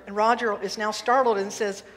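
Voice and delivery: ordinary talk, nothing marked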